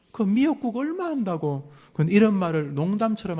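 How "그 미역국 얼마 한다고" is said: higher, scornful taunt; mocking parent taunt. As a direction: sneer it down at the child. Parent taunting child, quoted. (비꼬는 부모 말투)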